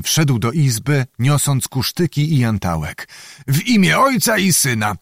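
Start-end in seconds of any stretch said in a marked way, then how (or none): 3.5-4.9 a raised, surprised exclamation; exclaiming. A narrator's reading. Zagłoba greets the servant back from the dead, surprised. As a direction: cry out in mock astonishment at the sight. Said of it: "zawołał pan Zagłoba".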